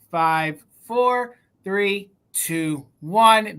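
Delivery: ordinary talk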